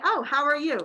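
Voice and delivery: breezy polite greeting; chirpy small talk. Shown as a demonstration. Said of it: "am I literally asking".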